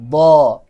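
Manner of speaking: loud, drawn-out "ضا" with tongue side on the molars; teacher's model pronunciation. Shown as a demonstration. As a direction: model "ضا" firmly for pupils to repeat. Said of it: "از پشت من تکرار بکنین".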